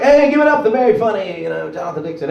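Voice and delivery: high, loud emcee hype; host intro. Retold or imitated. Imitating the club host introducing him, loud emcee hype. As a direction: pump up the crowd like an MC. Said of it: "he's like".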